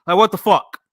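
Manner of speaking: loud, exasperated what the fuck; real angry outburst. Real, not acted. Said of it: "that shit just pisses me off".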